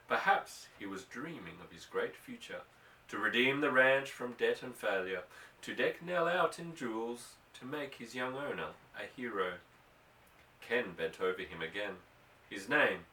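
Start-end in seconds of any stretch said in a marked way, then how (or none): none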